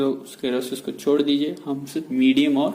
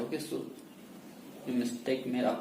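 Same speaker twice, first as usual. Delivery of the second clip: usual speaking voice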